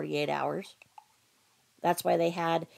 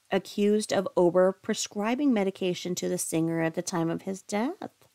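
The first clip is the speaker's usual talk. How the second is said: normal talking voice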